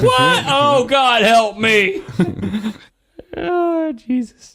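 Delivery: high squeaky despairing cry; mock shriek. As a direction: shriek it like you're ruined.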